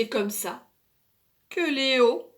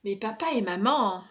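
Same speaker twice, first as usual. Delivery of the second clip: plain reading voice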